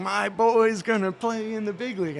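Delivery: put-on beaming dad bragging about his boy; proud dad. Father announcing his son's future with pride. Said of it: "just saying".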